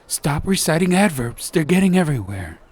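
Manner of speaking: loud, high and rushed; frantic. Acted. Fen begging Duroc to stop, loud frantic rush. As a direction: urge him to stop in a panic. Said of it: "said Fen frantically".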